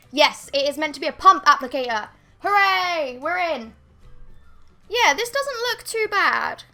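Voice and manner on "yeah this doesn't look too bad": usual speaking voice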